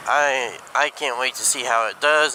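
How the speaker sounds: ordinary talk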